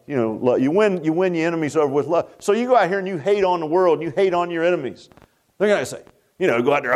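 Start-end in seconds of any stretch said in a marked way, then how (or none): none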